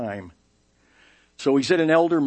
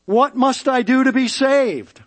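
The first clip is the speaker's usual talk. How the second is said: put-on eager rich young ruler; 11 semitones higher, 7 dB louder than usual. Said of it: "the rich young ruler".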